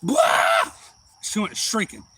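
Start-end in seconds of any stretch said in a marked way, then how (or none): 0.0-0.7 sudden loud, very high yelp; explosive high-pitched blah; mock shriek. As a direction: blurt an imitated scream. Imitating little girl's startled shriek.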